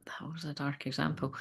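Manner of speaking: whispered aside to herself. Real, not acted. Whispering to herself after giving a dark example. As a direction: breathe it out under the breath.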